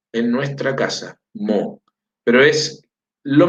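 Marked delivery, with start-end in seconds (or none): none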